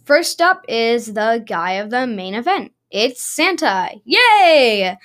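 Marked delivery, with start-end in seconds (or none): 4.0-5.0 loud happy yay; excited cheer. Real, not acted. Cheering for Santa.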